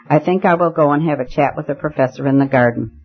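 ordinary delivery, nothing marked

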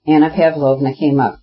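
ordinary delivery

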